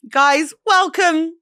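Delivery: slow high announcer welcome; high, drawn-out presenter greeting; podcast intro. Acted. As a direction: open the show like a host. Show intro.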